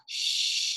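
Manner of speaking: a whispered, drawn-out "Sh"; hissing sh. Shown as a demonstration. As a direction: hiss "Sh" to show the CH sound. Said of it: "Esse som chiado".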